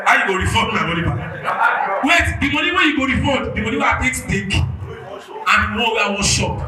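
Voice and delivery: loud, heated demand for refund; shouting complaint. Real, not acted. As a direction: yell it out, fed up. Angry fan demands refund.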